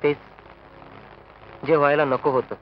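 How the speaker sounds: low, quiet, defeated voice; despondent. Acted. Man with nothing left to say, low quiet despair. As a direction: speak as if all hope is gone. Hints at the disaster.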